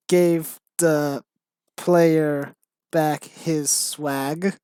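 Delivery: slow mock gangster, drawn-out words; hammed-up gangster, word by word. Writer reading out own embarrassing script, mock gangster. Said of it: "stereotype of how gangsters talk".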